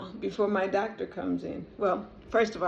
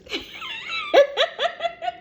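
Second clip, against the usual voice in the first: high burst of real laughter; big laugh; 3 dB louder than usual. Real, not acted. Patient laughing at her joke with the doctor, bursting laugh.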